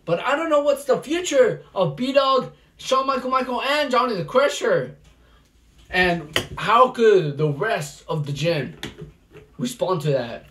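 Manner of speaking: loud, pumped-up wrestling commentary with yells at big moves; live play-by-play. Acted. Commentator calling a backyard wrestling match, hyped play-by-play. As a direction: call the match live for the crowd.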